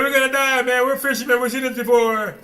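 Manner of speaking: mock panic in the storm; panicked disciples. Disciples in the storm crying out, high and loud.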